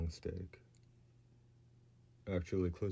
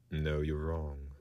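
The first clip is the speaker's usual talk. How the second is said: slow firm no; 3 dB louder, much slower than usual. Acted.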